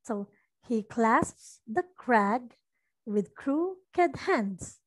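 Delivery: normal talking voice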